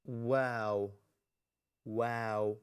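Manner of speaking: "Wow" said slowly, with rounded lips; demonstrated pronunciation. Shown as a demonstration. Teacher showing students the Arabic letter, "Wow" slowly articulated with lips. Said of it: "look at the way we pronounce it".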